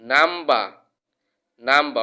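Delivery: "নাম্বা" ending on an open a, no r; silent R. Shown as a demonstration. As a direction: drop the final r in "নাম্বা". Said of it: "আর silent থাকবে".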